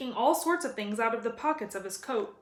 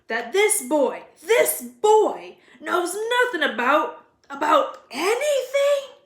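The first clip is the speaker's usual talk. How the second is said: louder and higher to a slow peak; angry building outrage; 6 semitones higher, 8 dB louder, slower than usual. A narrator's reading. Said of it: "he growled at the Dursleys".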